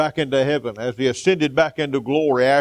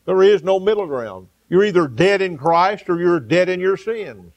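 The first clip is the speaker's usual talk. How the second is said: rising, louder revival preaching; fervent preaching; 4 semitones higher, 4 dB louder than usual. Acted. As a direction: preach it out with fire to the congregation. Preaching at full force.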